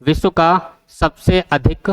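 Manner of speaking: slow, with अधिक pressed; slow emphasis. Shown as a demonstration. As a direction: lean on सबसे अधिक to warn the class. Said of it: "यहाँ ध्यान रखना है".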